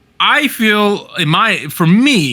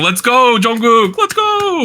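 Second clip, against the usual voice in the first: high-pitched congratulatory cheer, rising higher at the end; hyped cheer; 6 semitones higher, slower than usual. Real, not acted. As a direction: cheer him on, stretching the last "go". Cheering on the newly engaged star.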